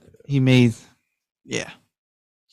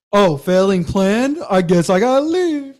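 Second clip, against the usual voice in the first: mocking The Rock; 7 semitones higher than usual. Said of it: "he was like".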